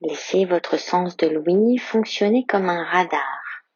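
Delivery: ordinary talk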